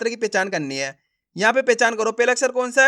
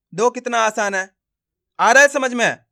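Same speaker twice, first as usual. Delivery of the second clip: ordinary talk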